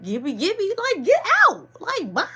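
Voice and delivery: yelling, pitch way up; mock high yell telling her to leave; high shouted shooing. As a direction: shoo her off loudly. Shooing girl off the porch.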